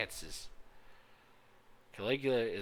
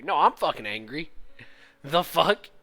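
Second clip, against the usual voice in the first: loud, high indignant outburst; hammed-up anger at the screen; mock angry; 3 semitones higher, 8 dB louder than usual. Real, not acted. Streamer yelling back at the game character, loud mock anger.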